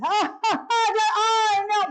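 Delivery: high, slow mock guffawing; hammed-up, high and rowdy chatter; mimicking loud visitors. Imitating the noisy people by the next bed, raucous. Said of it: "they were just so loud".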